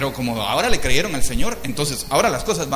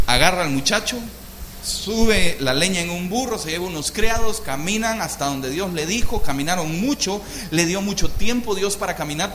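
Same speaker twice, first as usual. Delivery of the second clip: usual speaking voice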